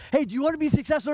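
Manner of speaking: high, loud and fast pumped-up question; hyped host. Retold or imitated. Speaker asks a seminar crowd, loud, high, fast hype. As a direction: fire up the room like a hype man.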